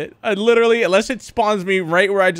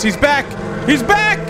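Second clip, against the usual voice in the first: rising alarmed shout, much higher; he's back, higher and higher; excited yell; 7 semitones higher than usual. Real, not acted. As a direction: yell it out as the boss shows up. Boss returns, yelling.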